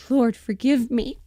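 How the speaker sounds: a heroine's hammed-up plea for forgiveness; dramatic plea. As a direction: cry out to the Lord, drawn out.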